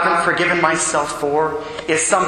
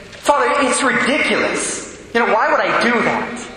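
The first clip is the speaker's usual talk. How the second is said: higher, scornful put-down of herself; harsh self-scolding tone; 5 semitones higher than usual. Retold or imitated. Penitent woman telling priest her faults, imitated harsh self-scorn. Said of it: "And just this tone".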